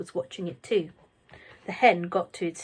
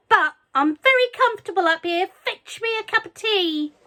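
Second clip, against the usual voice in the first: bossy grandma; 11 semitones higher, 7 dB louder than usual. A narrator's reading. Grandma bossing from the roof.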